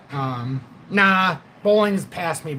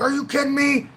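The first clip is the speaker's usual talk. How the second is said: incredulous coach; 9 semitones higher than usual. Retold or imitated. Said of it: "He's like".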